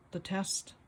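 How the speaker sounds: ordinary delivery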